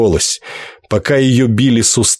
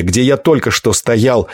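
ordinary delivery, nothing marked

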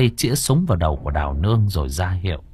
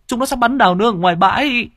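even higher, faster and louder panicked yell; frantic shout, rushing; 14 semitones higher, 4 dB louder, faster than usual. Acted. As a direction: blurt it out at the peak of panic. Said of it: "Tiếng kêu vang vọng".

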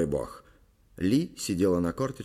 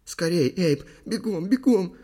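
high, pressing, voiced whisper; urgent stage whisper; 9 semitones higher, 5 dB louder, slower than usual. A narrator's reading. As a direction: urge him on in fright. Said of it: "шептала Ли". (торопливый испуганный шёпот)

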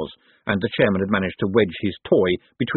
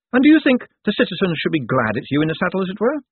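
lightly voiced reporter, higher pitch; reporter Saccharissa, probing; 10 semitones higher than usual. A narrator's reading. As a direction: press the question in a higher voice. Reporter questions Moist publicly.

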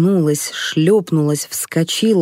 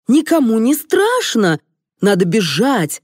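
raised, higher, forceful delivery; exasperated directing; 6 semitones higher, 3 dB louder than usual. A narrator's reading. Director scolds the actress crawling as a dog, exasperated. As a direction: drive the performer on with impatience.

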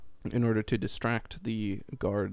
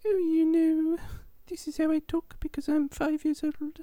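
put-on very high, whiny child voice; little boy voice. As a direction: squeak it like a five-year-old. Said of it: "He's like".

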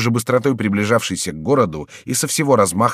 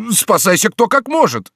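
high, loud wail; panicked yell; 12 semitones higher, 5 dB louder than usual. A narrator's reading. Guard captain to his men, yelling in panic. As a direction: yell for everyone to flee. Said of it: "завопил начальник караула".

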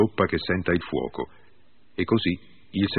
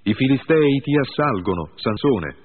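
high, loud cry of alarm; shouted warning; 7 semitones higher, 4 dB louder than usual. A narrator's reading. Woman shouting to rouse her sleeping lover, loud alarm. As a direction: call out sharply as if danger is at the door. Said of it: "Poi gli gridò".

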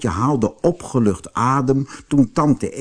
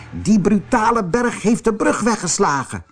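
higher-pitched indignant aunt; exclaiming aunt; 9 semitones higher than usual. A narrator's reading. (hoge, verontwaardigde tantestem)

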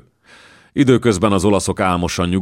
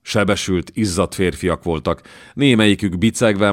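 plain reading voice

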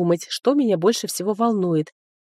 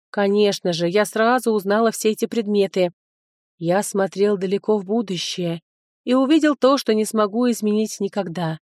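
ordinary delivery, nothing marked

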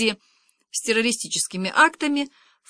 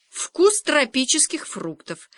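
slow, higher-pitched parrot doing an advert; TV commercial voice; 6 semitones higher, slower than usual. A narrator's reading. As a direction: pitch it like a television ad slogan. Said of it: "голосом телевизионной рекламы ответил попугай".

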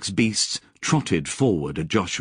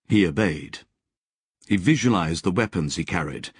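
ordinary delivery

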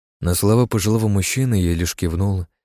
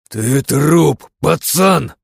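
loud, high menacing snarl; angry growl; 7 semitones higher, 6 dB louder, much slower than usual. A narrator's reading. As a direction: growl it out in fury. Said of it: "прорычал зло я".

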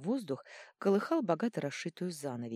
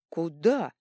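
astonished; 8 dB louder than usual. A narrator's reading. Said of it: "изумился Вырвиглот".

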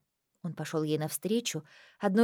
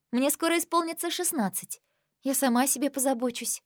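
put-on young girl voice; 6 semitones higher than usual. Girl insists she is grown.

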